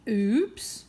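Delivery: plain reading voice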